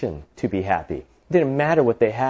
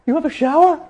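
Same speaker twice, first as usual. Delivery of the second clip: very high, amazed question; astonished. Acted. Driver envying woman's shower, astonished. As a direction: squeak it in envious disbelief.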